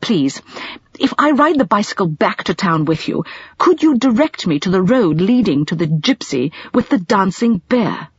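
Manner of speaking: ordinary delivery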